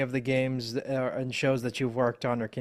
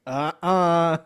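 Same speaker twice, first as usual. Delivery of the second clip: loud, higher mock objection; disapproving listener; 5 semitones higher, 9 dB louder than usual. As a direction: cut in like a listener rejecting a bad accent. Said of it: "people are gonna listen to it and be like".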